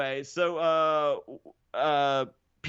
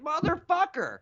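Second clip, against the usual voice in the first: mock curse; 10 semitones higher than usual. Garrett's reaction retold.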